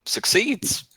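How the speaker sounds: high, rising reveal after a wait; suspenseful reveal. Real, not acted. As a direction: lift the result up high. Game master reveals the final roll.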